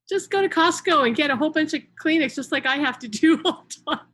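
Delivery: loud, high, laughing joke. Real, not acted. Telling viewers to stock up on Kleenex, laughing.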